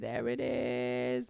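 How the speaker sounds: slow, low creak to start; creaky voice demonstration. Shown as a demonstration. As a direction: let the words creak. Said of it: "There's the creaking".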